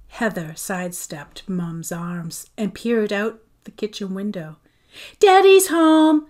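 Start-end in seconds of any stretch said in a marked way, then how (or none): 5.2-6.3 loud, high, slow announcement; announcing. A narrator's reading. Daughter announcing her father's arrival, loud and slow. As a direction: call it out flatly. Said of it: "she announced".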